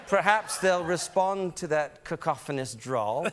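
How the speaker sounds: slow, mocking English accent; imitated English disdain, drawn out; English relatives. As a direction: sneer drily in a measured English accent. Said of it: "my relatives".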